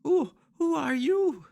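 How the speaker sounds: put-on high timid child asking a stranger; frightened little boy. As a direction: ask it like a lost boy waking from shock. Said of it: "and he goes".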